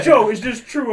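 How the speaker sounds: a teacher checking on a student; concerned teacher. Retold or imitated. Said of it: "Miss Miller's like".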